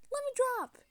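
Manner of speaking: high, loud play-acted cry; pretend distressed yell. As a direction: yell out as a struggling captive. Captive cries out in struggle.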